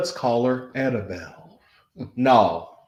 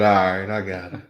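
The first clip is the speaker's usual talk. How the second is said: mimicking friend; 6 semitones lower than usual. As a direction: lower mocking repeat of friend. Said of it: "He said".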